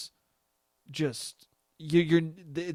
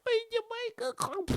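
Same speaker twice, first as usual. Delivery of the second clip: put-on very high, soft baby voice; cutesy voice; 5 dB quieter than usual. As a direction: coo like a smitten partner in bed. Voicing pillow talk cutesy.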